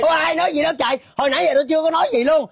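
high-pitched protest of innocence; indignant denial. Acted. Accused gossip denying it to her accusers, indignant. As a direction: plead innocence, pitched well up.

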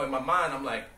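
ordinary talk

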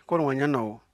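ordinary talk

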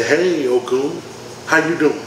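flirty woman's greeting. Acted. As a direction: stretch the greeting like a woman with a way about her. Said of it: "she got that way about her".

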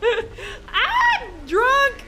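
loud, high laughing yell; laughing shout. Real, not acted. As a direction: crack up while yelling.

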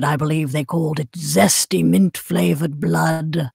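dark, low, slow female villain; Wilhelmina Afton. Acted. Villainess recalling ice cream with relish, low sinister British voice. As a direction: savour each phrase slowly in a posh British accent. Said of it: "make it really more of a Wilhelmina accent".

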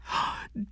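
quick, soft intake of breath; amazed gasp. Acted. Diane amazed by the news.

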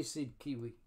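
ordinary delivery, nothing marked

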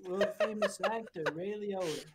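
lightly put-on film star voice; a hint of celebrity impersonation; Ray Liotta. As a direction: greet him as a self-important famous actor. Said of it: "yes, I am Ray Liotta".

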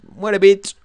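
loud high taunt; taunting shout. Real, not acted. Gamer taunts an enemy with a loud high yell.

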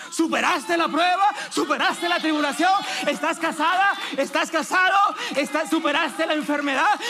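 loud, high, shouted promises to the congregation; fiery prophetic proclamation, building to a shout; full-force preaching. Acted. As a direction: preach it at the top of your voice, rising.

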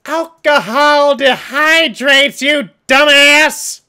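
slow, high, loud yell. Real, not acted. Yelling at the note writer, loud and exasperated. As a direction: scold the note writer at full volume.

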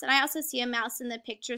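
ordinary talk

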